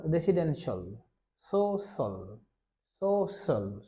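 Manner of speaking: careful dictation with a rounded shol ending; model pronunciation. Shown as a demonstration. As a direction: end each word in one syllable, "shol". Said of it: "এটাকে আমরা উচ্চারণ করব সল".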